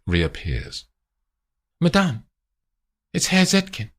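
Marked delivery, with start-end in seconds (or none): 1.8-3.9 lightly voiced, higher and polite; mild servant voice; a hint of the chauffeur. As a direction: announce the guest dutifully. Chauffeur announcing the visitor.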